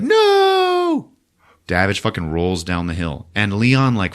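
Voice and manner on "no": very high anguished no; boy crying out. Retold or imitated. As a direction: wail it as the uncle is shot. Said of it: "Zombies is like".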